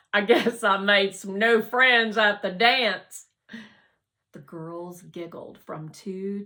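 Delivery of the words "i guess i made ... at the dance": grinning boast. A narrator's reading.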